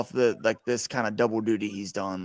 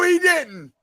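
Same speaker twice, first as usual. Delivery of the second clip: excited shout; 3 dB louder than usual. Real, not acted. Shocked reaction to big hit.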